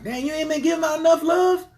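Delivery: high, loud, exasperated plea; high incredulous complaint to the universe; mock questioning the universe. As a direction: whine it up at the sky. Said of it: "be like".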